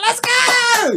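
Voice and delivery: high whooping cry; retold elated cheer. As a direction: whoop it like a celebration. Retold cheer at craft beers.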